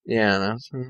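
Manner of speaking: ordinary delivery, nothing marked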